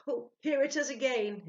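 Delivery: high, loud, terrified spirit; frightened. A narrator's reading. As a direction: cry out in fear. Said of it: "it wrote in trembling characters".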